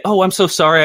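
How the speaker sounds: high, apologetic British sorry; mock British apology. Imitating a Brit bumping into someone, high and apologetic. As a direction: act out the flustered apology. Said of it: "you'd say".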